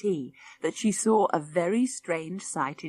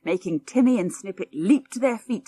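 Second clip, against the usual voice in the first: plain reading voice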